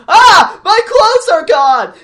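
high, loud, slow shriek of dismay; mock cartoon dog yell. Friend mocking the dog's line in a loud, high, slow shriek. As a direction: wail it like a silly cartoon dog. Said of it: "Dookie goes".

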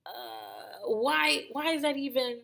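high-pitched, halting disbelief; baffled, searching questions with long stalls; acted bafflement. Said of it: "A lot of it I'm like".

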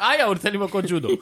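cracking up while complaining; laughing yell. Real, not acted. Host laughing as the rapper yells at him.

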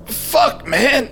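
high, breathy strained gasp; strained in pain. Acted. Hero hit in the groin, strained. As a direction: squeeze it out doubled over. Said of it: "voice strained and doubled in half".

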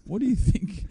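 high-pitched mock indignation; indignant protest. Musician to heckling wedding guests, mock indignant protest. Acting out musician answering rude punters.

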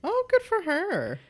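high, swooping delight on "good for her"; delighted cheer. Real, not acted. Co-host applauding Virginia joining the hoax, delighted.

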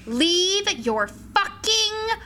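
very slow, drawn-out high shout; mock-outraged announcement. Public service announcement to males on Bumble, mock-outraged shouting. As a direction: proclaim each word loudly, stretching it out.

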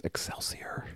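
hushed, voiceless whisper. Acted. Stan Lee leaning in to an artist's ear, whispering. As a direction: whisper it secretively into someone's ear. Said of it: "leans in and whispers in his ear".

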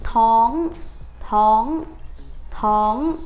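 thón with a short rising sắc tone, not thống; correct thón. Shown as a demonstration. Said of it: "chứ không phải là thống". (đọc đúng thón, thanh sắc)

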